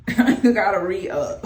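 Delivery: a real laugh breaking through the words. Real, not acted. Vlogger laughing to viewers about her near-empty oil bottle.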